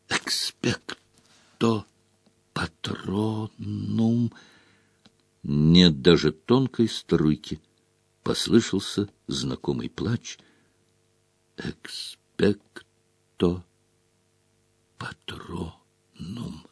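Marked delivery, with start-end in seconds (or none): 0.1-4.3 faint fading voice. A narrator's reading. Harry's patronus fading. 11.6-16.7 barely audible whisper. A narrator's reading. Harry losing strength.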